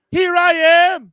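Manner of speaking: loud, high, drawn-out showman cry; grand entrance shout. Pretend. Guest bursting onto the show, shouting theatrically. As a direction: belt out your arrival, stretching it.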